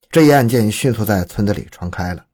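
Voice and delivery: usual speaking voice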